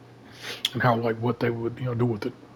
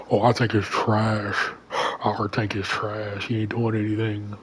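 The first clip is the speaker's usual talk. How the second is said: slow, drawn-out mock complaint; mock anger; 4 dB louder, slower than usual. Teammates blame their only tank, mock anger. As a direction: imitate griping teammates, dragging the words. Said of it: "they wanna get mad at me".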